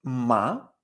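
"ma" gliding upward; rising second tone. Shown as a demonstration. Teacher demonstrating the second tone, "ma" rising. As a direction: say "ma" rising like a question. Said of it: "siempre es ascendente el sonido".

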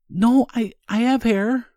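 high, squeaky adolescent voice; voice cracking high. Acted. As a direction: squeak like a boy in puberty. Puberty joke, higher voice.

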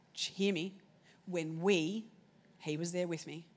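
low, soft and slow, God speaking tenderly. Retold or imitated. Holy Spirit gently tells Cherie at the grave, low and soft. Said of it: "The Holy Spirit said to me".

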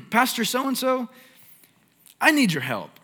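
mock husband pleading high and loud; preacher retelling a husband's phone call, high and loud; frantic husband. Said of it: "the husband was like".

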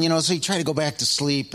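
ordinary talk, nothing marked

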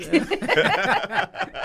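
loud high laugh; high burst of laughter. Real, not acted. Guest recalling the banker, bursting into laughter. Laughing at his own story.

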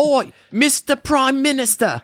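mock Australian greeting; mock Aussie. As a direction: drawl it out like a cartoon Aussie. Mocking Australians.

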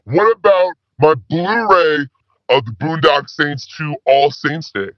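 ordinary talk

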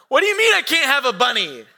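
put-on high, loud childish protest; hammed-up indignant little kid; upset child. Five-year-old challenging dad from back seat, indignant protest.

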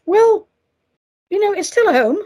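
high, loud mock reassurance; chirpy consoling singsong.

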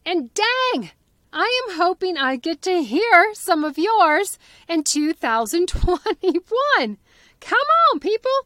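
high, loud, eager call to the listeners; rally the audience, come on people; excited plea. Real, not acted. Host urging her podcast listeners to share, excited.

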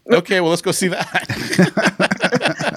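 slow, high, laughing okay; laughing resignation. Retold or imitated. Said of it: "So we're like".

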